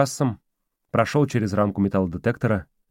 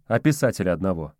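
plain reading voice

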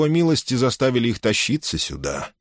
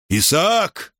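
высокий протяжный окрик; окликни племянника, повышая голос; взволнованный окрик; 10 semitones higher than usual. A narrator's reading. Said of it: "закричал Иоанн Дука". (взволнованно, криком)

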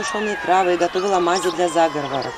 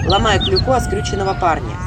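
ordinary delivery

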